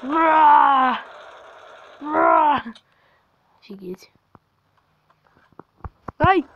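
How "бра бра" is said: startled shout. Real, not acted. Scared by jumpscare.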